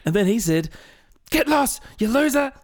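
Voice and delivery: high-pitched, worked-up retelling of an argument; mock annoyance. Acting out someone retelling a row, mock annoyed. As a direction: relive the row, voice shooting up. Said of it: "they get as annoyed as they were during that conversation".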